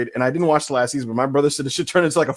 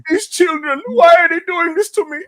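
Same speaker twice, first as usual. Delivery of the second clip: imitating a Jamaican Grim Reaper, pitched high; a hammed-up, high Jamaican accent; 11 semitones higher than usual. Friend mocks the Jamaican Grim Reaper in a high, hammed-up accent. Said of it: "why is he like".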